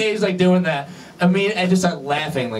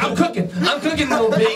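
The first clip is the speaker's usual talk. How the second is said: higher-pitched imitation of an eager fan; excited fan; 7 semitones higher than usual. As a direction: act out a fan proudly showing off to his idol. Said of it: "He's like".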